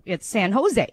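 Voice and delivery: officer saying "San Jose" with no J sound, like San Hosay; San Jose as "San Ho-say", soft h for the J; officer correcting pronunciation. Retold or imitated. Officer correcting a driver's pronunciation, San Jose as San Hosay. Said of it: "here we don't pronounce the J".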